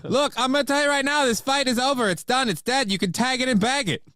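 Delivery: very high, emphatic mock host voice; Kevin Brennan. Co-host setting up a sound drop, high ranting impression. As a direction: declare it over in a squawked impression. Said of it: "Get your Brennan drop ready".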